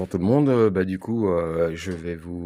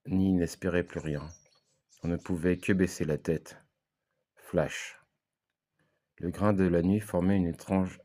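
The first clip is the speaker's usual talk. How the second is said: measured poetic recitation with long pauses; spoken-word reading. Acted. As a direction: read it as spoken word, phrase by phrase, letting each image hang. Recites own spoken-word text.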